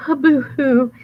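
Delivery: high, loud fake boo-hoo; mock moaning. Wolf in disguise fake crying, hammed-up. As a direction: ham up the sobbing.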